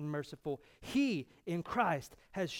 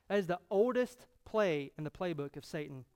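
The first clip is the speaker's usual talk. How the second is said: normal talking voice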